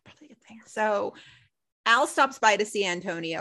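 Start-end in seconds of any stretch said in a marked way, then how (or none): 0.0-0.6 breathy whispered aside to herself; under-the-breath mutter. Real, not acted. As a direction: drop to a whisper, half to yourself.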